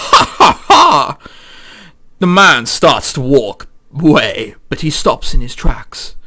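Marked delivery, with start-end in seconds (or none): 0.1-1.2 gloating laugh. Acted. Villain laughs after show.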